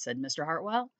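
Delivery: ordinary delivery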